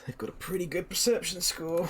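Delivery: whispered aside to self; whispering. Real, not acted. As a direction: breathe it under your breath. GM reading to himself.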